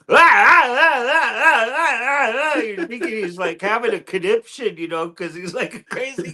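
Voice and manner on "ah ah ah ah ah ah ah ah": high loud mock yelps; crazed ah cries. Retelling the straitjacket scene, high hammed-up ah cries. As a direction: cry out like an asylum inmate itching.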